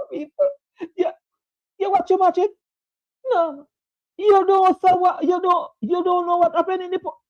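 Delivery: high-pitched, giggling through the words; laughing. Real, not acted. Host teasing viewers who missed the match, laughing high. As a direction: laugh as you scold the viewers. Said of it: "I don't know why I'm laughing tonight".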